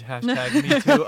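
high, loud laughing punchline; laughing joke. Acted. Singer jokes about audition packages while laughing. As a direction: crack up on the punchline.

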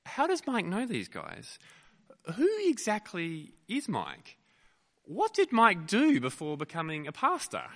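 acted bewildered thought; puzzled wondering. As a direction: wonder aloud, piling up suspicious questions. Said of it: "you're thinking to yourself".

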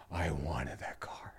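hushed, slow whisper of longing; whispering how much he craved that car. Real, not acted. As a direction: breathe it like a secret confession.

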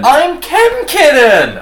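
high, loud, drawn-out exclamation; hammed-up squeal of recognition; mock thrilled reveal. Acting out the screen-name revelation.

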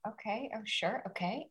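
soft, deflated mumble of agreement; quietly swallowing the sting; hurt quiet okay. Retold or imitated. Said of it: "And I'm listening going".

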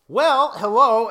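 slow, high, loud showman hello; drawn-out welcome-back hello; podcast host greeting. Acted. Show intro after countdown.

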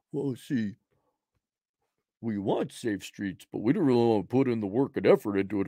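low, mumbled excuse-making voice; mocking low-voiced feeble justification; mock sheepish excuse. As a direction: play someone lamely explaining themselves, pitched low. Acts out excuse-making residents.

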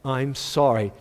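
low, reluctant acted apology; insincere apology. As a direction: force out a nicer sorry without meaning it. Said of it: "say it like you mean it".

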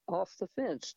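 usual speaking voice

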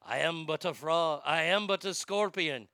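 low, resigned mock French accent. Scorpion excusing itself to the dying frog, French accent. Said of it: "Scorpion says".

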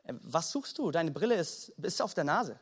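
low, soft, baffled aside; puzzled inner thought. Acted. Friend looks at the glasses wearer and thinks, low and puzzled. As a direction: think it aloud, quietly bemused. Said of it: "du schaust ihn an und denkst dir".

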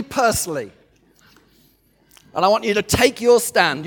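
ordinary talk

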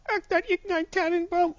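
whiny cartoon Hitler with a German accent. Cartoon Hitler asking for a tree in a German accent. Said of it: "Your German accent's better".

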